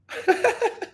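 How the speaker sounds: high-pitched giggling burst; real, high triumphant laughter; gleeful laugh. Real, not acted. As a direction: crack up in delighted gloating. Gloating as the viewer returns.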